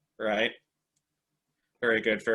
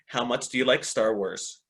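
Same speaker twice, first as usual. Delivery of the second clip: ordinary delivery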